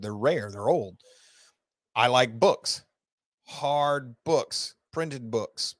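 normal talking voice

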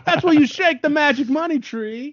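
put-on high Jamaican accent; a playful Jamaican accent. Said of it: "I'm doing a Jamaican accent".

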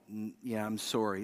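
put-on soft, gentle British-accented apology; a hint of a polite British expert, said softly; kind British apology. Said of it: "They do it so kindly with a British".

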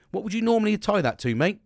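usual speaking voice

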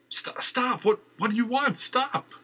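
high, stammering pleas to stop; desperate cries. Acted. Valerie cries out as Gregory shreds her clothes. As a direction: cry out in panic.

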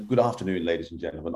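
a calm pilot addressing the cabin; airline captain. Shown as a demonstration. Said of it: "I could do the airline captain".

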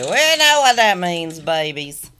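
rally the audience for the last one; hyped host call. Acted. Host revving up listeners for the final clip, excited.

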